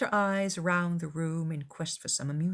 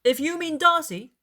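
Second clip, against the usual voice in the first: loud, higher cry; cried out; 10 semitones higher, 7 dB louder than usual. A narrator's reading. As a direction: call it out brightly. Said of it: "cried her brother".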